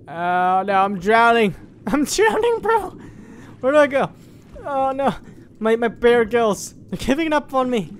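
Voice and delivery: high, loud hammed-up panic; mock panic. Player whose bear is drowning, high mock-panicked cries. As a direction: wail it as if going under.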